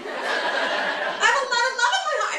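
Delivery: high-pitched, strained declaration of love; mock yelling. Shown as a demonstration. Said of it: "always think I'm fucking yelling at them".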